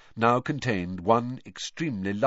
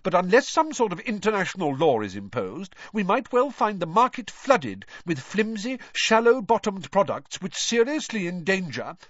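heated; 10 semitones higher, 4 dB louder than usual. A narrator's reading. Older brother defending his report, heated. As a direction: hit back, stung and defensive. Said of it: "he said heatedly".

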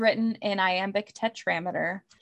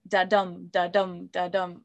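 "da-dum" with the weight on "dum"; stress on second syllable; 2 semitones lower, 3 dB quieter than usual. Shown as a demonstration. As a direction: say "da-dum" as a steady beat, leaning on "dum". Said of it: "the emphasis is on the second syllable".

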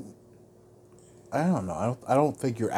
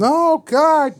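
alarmed protest; 8 dB louder than usual. Real, not acted. Partner quits talking in mock huff.

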